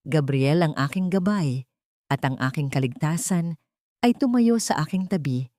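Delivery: ordinary delivery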